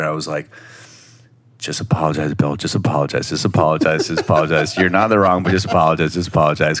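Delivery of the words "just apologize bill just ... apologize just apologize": low, repetitive mantra; insistent low-pitched chanting to himself; low self-coaching. Retold or imitated. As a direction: talk yourself into it in a lowered voice. Repeating self-talk to make himself apologize.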